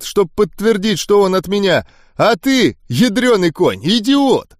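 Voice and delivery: high-pitched, exasperated scolding; angry tirade. A narrator's reading. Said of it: "Он озлобленно махнул рукой". (раздражённый разнос высоким голосом)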